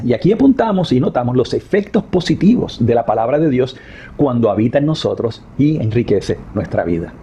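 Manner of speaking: ordinary delivery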